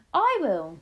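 high, loud determined cry. A narrator's reading. Grandmother insisting to grandchild, crying out. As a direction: call it out high and firm. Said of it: "she cried".

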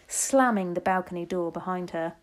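usual speaking voice

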